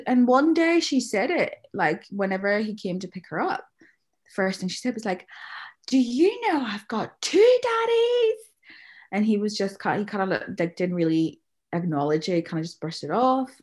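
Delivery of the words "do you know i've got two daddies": put-on high, slow, innocent little-girl voice; sing-song child asking her dad; imitating little daughter. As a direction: ask it like a curious small child. Voicing her little daughter's question.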